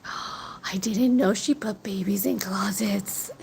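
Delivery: hushed, unvoiced little girl's aside; whispered quote. Little girl whispering her shock about babies in closets. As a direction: breathe it out, slow and secret. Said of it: "she was like".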